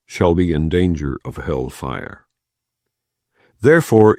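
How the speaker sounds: ordinary delivery, nothing marked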